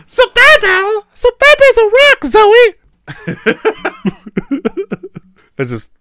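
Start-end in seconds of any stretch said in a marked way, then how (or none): 0.1-2.7 silly high squeal. Acted. 3.1-5.2 giggles. Real, not acted. Laughing at own joke.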